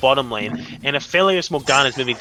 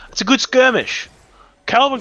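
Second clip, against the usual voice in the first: excited; 11 semitones higher, 5 dB louder than usual. Real, not acted. Caster hyping a skirmish.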